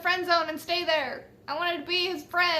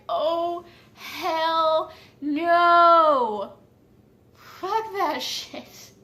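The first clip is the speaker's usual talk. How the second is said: acted outraged refusal; 7 dB louder, much slower than usual. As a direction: belt out mock outrage, dragging it out. Acted inner outrage on learning meaning.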